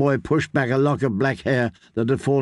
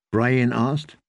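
ordinary delivery, nothing marked

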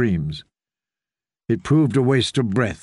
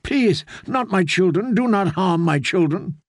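begging; 7 semitones higher than usual. Acted. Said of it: "she begged".

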